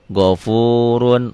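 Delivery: Ghafurun with a thick ro, slow; Arabic example word. Shown as a demonstration. Teacher reciting Ghafurun to students, slow. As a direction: recite Ghafurun slowly, heavy ro. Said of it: "ro-nya baris di atas".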